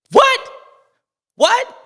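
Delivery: high, loud startled yell of what; retold cry of surprise at the crash; shocked what. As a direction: blurt it out in disbelief. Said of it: "I was like".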